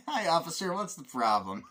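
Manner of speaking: high, loud greeting between giggles; amused greeting. Retold or imitated. Driver greets the policeman walking over, amused greeting. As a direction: play innocent while cracking up.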